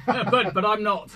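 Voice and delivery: laughs through a denial; laughing. Real, not acted. Guest laughing off being called English. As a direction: laugh it off, amused.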